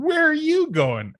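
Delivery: imitating a coworker's surprised call; surprised waitress. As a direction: toss off the waitress's startled question. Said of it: "looking at me and going".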